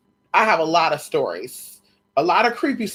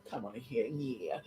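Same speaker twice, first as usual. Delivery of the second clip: soft coo; 7 semitones lower, 15 dB quieter than usual. Pretend. Cooing to the dog.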